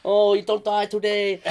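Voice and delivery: high, loud mock Chinese accent; hammy prank-call Chinese accent. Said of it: "the Chinese accent".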